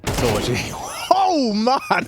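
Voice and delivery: ordinary talk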